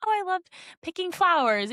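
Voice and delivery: put-on high, sweet kid voice; little child. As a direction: imitate an innocent small child. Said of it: "from a little child".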